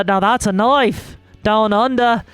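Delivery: badly attempted Australian accent; amateur Australian impression. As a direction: put on a hammy Aussie accent. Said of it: "try to do an Australian accent".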